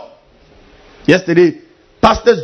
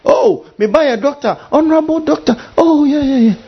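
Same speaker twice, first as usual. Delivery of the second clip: high, preening mock doctor; mock proud doctor; 9 semitones higher than usual. Preacher mocking an honorable doctor, high and preening. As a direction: puff up like a vain doctor.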